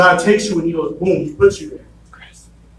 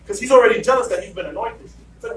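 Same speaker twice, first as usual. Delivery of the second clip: ordinary talk, nothing marked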